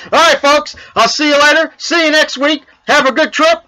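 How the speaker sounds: upbeat show sign-off. Acted. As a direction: pump up the sign-off. Sign-off host voice raised.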